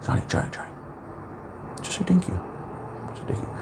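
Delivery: very soft, nearly whispered urging; hushed self-correction. Retold or imitated. Telling himself to accept a compliment, hushed and urgent. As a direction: rein yourself in under your breath.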